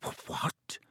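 hushed, stuttered question; stammering. Acted. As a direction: stammer it softly, taken aback. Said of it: "Harry stammered".

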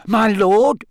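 high, loud servile gasp. Acted. Wormtail on the floor to his master, gasping. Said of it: "gasped the man on the floor".

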